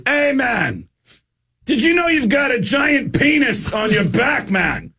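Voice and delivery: a comic imitation of the famous singer; gravel-voiced rocker. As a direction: ham up the rock star pointing out the tattoo. ACDC singer voiced in story.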